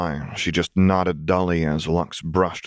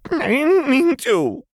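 very high, louder, slow sobbing; sobbing wail; 5 dB louder, slower than usual. A narrator's reading. Jinx pleading to Lux, sobbing high and loud. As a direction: wail it through tears. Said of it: "Jinx sobbed".

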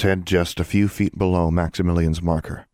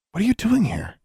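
much higher, startled question; surprised. Acted. Girl finding Martin in the crypt, surprised. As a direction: catch your breath at the sight.

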